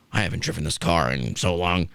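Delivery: put-on a confused old boss making excuses; dazed old man. Host imitating Vince's lame excuse to the cop, low and dazed. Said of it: "but he's like".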